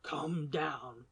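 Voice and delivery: low, soft hoarse order; tense master whispering. A narrator's reading. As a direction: rasp it under your breath. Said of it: "hoarsely whispered".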